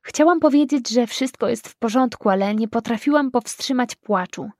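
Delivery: ordinary delivery, nothing marked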